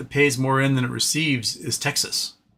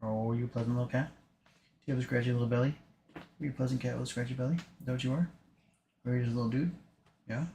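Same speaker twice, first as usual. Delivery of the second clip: talking to a pet; 11 dB quieter, faster than usual. Real, not acted. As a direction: coo at the cat. Cooing to the cat.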